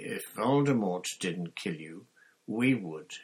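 plain reading voice

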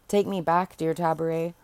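usual speaking voice

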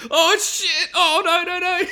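high, hammed-up cry of dread; mock-horrified high-pitched outburst; horrified panic. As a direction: wail as the disaster sinks in. Acts Woody seeing the explosion.

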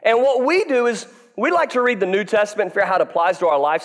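ordinary talk

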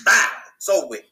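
usual speaking voice

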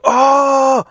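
sudden high, loud "Oh!"; surprised exclamation. Retold or imitated. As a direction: blurt it out in shock. Dad exclaims seeing fight.

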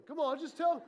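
normal talking voice

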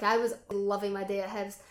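normal talking voice

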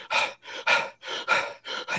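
imitated labored COPD breaths; short, voiceless huffs of struggling breath; emphysema puffing. Shown as a demonstration. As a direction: puff air out like an emphysema patient. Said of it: "emphysema kind of breathing".

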